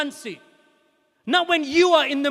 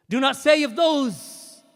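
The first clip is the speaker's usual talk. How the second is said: normal talking voice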